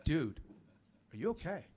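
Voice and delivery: soft, tentative question; hushed check. Retold or imitated. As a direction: ask gingerly, voice dropped. Asks him again, closer.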